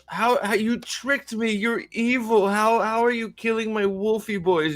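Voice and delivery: high, whiny indignant protest; mock outrage. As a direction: sputter in hammed-up betrayal. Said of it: "And they're like".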